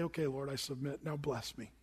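a meek, hushed plea; humble surrender. Retold or imitated. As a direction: hand it over to God gently. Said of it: "submit and say".

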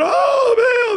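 very high, drawn-out wail; hammed-up frantic shriek to a god; mock scream. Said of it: "yelled and screamed and said".

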